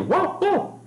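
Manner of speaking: high yappy imitated bark; dog bark. Teacher imitating a barking dog for students.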